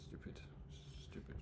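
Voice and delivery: fed-up hushed groan; exasperated mutter. Real, not acted. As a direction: mutter it under your breath.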